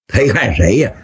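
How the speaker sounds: plain reading voice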